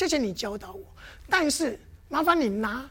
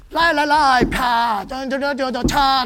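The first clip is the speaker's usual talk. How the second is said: mock busy one-man show; 7 dB louder, slower than usual. Mimics one man doing everything.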